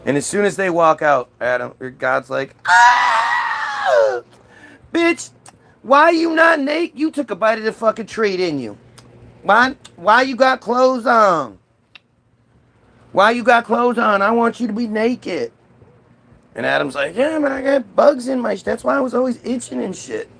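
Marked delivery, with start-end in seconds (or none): 2.7-4.2 very high comic scream; shocked scream. Acted. God sees Adam and Eve walk out, shocked scream. 4.9-15.5 high-pitched, hammed-up indignation; outraged scolding. God finds Adam and Eve clothed, outraged scolding.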